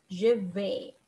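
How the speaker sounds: "je vais" said slowly and clearly, as zhuh veh; slow, careful "je vais" in French; correct je vais. Shown as a demonstration. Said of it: "It's not je avise à".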